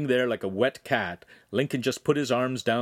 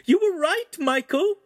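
very high, loud delighted squeal; 5 dB louder than usual. A narrator's reading. Signora looking back upstairs to Michael, squealing. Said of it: "she squealed".

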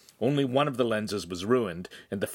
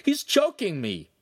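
indignant protest; 10 semitones higher, 4 dB louder than usual. A narrator's reading. Apprentice protests being choked.

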